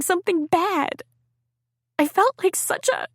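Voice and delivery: put-on high, hurt teenage girl voice pouring it out; a wounded girl confessing to her ex; young girl Liz, hurt.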